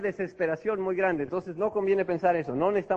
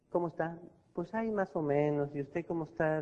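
unenthusiastic; 2 semitones lower, 5 dB quieter than usual. Shown as a demonstration. Bored customer greets the salesman, quiet and listless. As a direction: mumble it like nothing matters. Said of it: "no parece estar muy entusiasmado".

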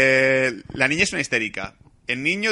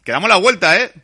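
mock shouted threat, much louder and higher; parent's threat; 7 semitones higher, 10 dB louder than usual. Fed-up parent threatening the kids in the car, mock shout.

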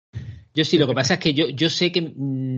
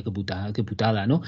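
normal talking voice

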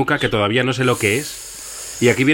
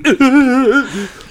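high startled squeal; fear squeal; 13 semitones higher, 5 dB louder than usual. Retold or imitated. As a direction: shriek like a spooked kid. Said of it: "además es que me sale como así".

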